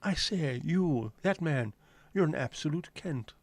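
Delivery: mock-scold him in a plummy posh English accent. Parodying a posh Cockney's insult.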